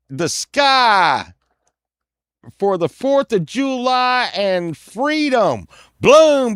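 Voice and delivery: high, loud hollering with booming gunshot noises; rowdy 1976 American. Acted. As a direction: whoop it up like a reckless Bicentennial patriot. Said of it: "a day in the life of a nineteen seventy-six person".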